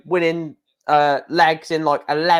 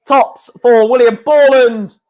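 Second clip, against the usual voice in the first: excited commentary call; 4 semitones higher, 8 dB louder than usual. Real, not acted. Live darts call on checkout.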